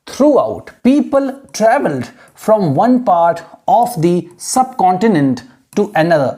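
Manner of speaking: very slow, careful reading aloud; model reading. Shown as a demonstration. Teacher modelling how students should read the sentence, slow. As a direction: read it slowly, word by word. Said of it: "जैसे मैं read कर रहा हूँ".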